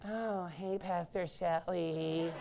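slow, soft parody voice; mock effeminate greeting. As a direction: greet the pastor softly, slowly, mocking. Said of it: "Not like".

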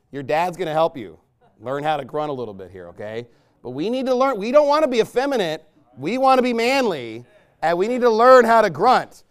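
normal talking voice

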